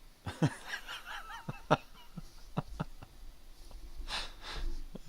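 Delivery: breathy high giggle; high wheezy laugh. Real, not acted. Laughing at his own dog voice, high and wheezy.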